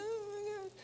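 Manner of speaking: hammed-up tearful falsetto, kept small; imitated crying. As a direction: squeak it like a sister in tears. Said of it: "well, she started crying".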